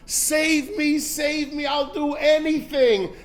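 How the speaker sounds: high, pleading cry for rescue; crying out from the depths. Retold or imitated. Man about to fall calls out to God, pleading high. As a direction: beg from the bottom of your heart. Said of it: "calls out Mimamakim from the depths of his heart".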